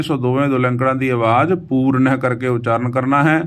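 ordinary talk, nothing marked

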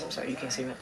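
usual speaking voice

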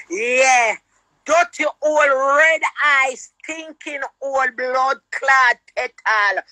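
ordinary talk

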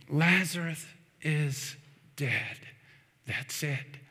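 low, hushed, falling away; grave news. Retold or imitated. Grim news breaks in the story.

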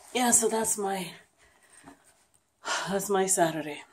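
normal talking voice

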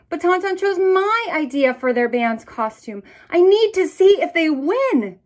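put-on upset child.

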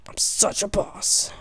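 a smug breathy aside, no voice; whispered boast. Acted. Gamer whispering a boast to himself during the fight. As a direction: whisper the brag to yourself.

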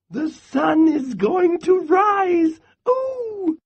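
very high, squeaky little squirrel voice; piped up. A narrator's reading. Little Brown Squirrel pipes up at Bear, high and squeaky. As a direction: pipe it up like a tiny excited squirrel. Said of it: "Little Brown Squirrel piped up".

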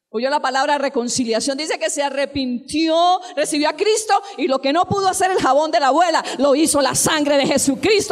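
loud, rising preacher's cry; fiery preacher crying aleluya over the congregation; preaching at full force. Acted. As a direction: build to a shouting climax, proclaiming freedom. Preaching climax.

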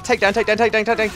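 high, loud urgent chant; frantic. Real, not acted. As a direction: rattle it off in a gamer's panic. Frantic attempt at in-game takedown.

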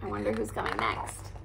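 soft, fast murmured aside; quiet aside. Real, not acted. Reader aside to the listening child, soft and quick. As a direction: drop your voice and rush the aside.

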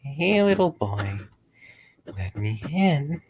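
put-on high, slow eerie whisper to a child; creepy whisper. A narrator's reading. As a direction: coax it slowly like a ghost at the window. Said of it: "He'd whisper loudly".

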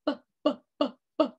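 "B, b, b" as short voiced b bursts; b sound. Shown as a demonstration. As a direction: pop the b sound again and again. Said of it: "what does B say".

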